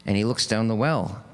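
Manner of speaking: ordinary talk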